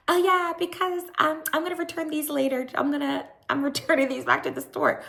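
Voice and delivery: very high, put-on mock voice; mimicking Whitney. Imitating Whitney explaining the tags to Lisa, high mock voice. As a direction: imitate her in a high, quick singsong. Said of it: "And Whitney was like".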